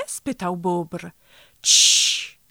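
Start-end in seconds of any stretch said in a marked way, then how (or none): 1.6-2.4 loud, long "shh"; sharp voiceless shushing sound; hissed shush. A narrator's reading. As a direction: hush them with a forceful hiss. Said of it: "syknął Franklin".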